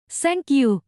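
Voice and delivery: put-on lightly Balinese-accented English; simple English with a hint of Balinese accent; English spoken with a light Balinese lilt. A narrator's reading. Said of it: "tanyanya dengan logat khas Bali".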